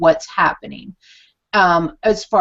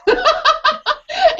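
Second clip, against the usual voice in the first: squealing laugh at her own joke; high burst of laughter. Real, not acted. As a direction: break into a big laugh.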